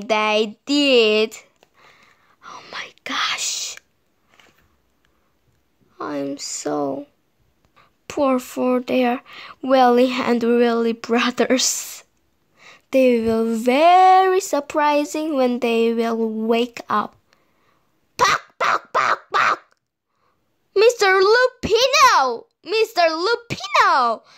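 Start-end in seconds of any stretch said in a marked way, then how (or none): 2.4-7.1 hushed aside; whisper. Real, not acted. Narrator aside. 18.2-19.6 high breathy clucks; hen clucking. A narrator's reading. 20.7-24.2 high alarmed calling; crying out. A narrator's reading. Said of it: "cried Missus Hen".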